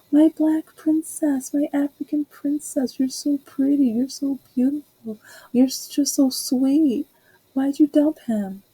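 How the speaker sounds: imitating the old neighbour fawning over her; sweet old lady voice. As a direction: coo like a doting elderly neighbour. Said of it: "there was a sweet old lady".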